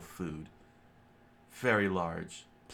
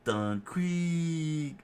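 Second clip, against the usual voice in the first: calling out; 9 semitones higher, 4 dB louder than usual. A narrator's reading. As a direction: shout the name, high and loud. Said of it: "Jin screamed out".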